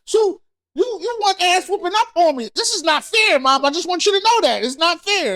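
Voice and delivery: put-on high, wronged-kid complaint; indignant protest. Son arguing with his late mom, mock indignant. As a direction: plead your case, pitch well up.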